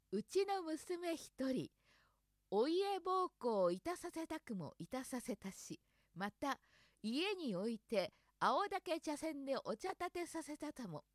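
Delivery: slow, careful tongue twister; slowly. Shown as a demonstration. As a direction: take it slow, syllable by syllable. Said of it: "ゆっくり言ってみる".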